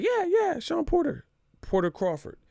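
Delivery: squeaky eager fan, way up high; high mock fan voice. Mocking eager fans pushing a matchup, squeaky high voice. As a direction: mimic eager fans pitching a fight.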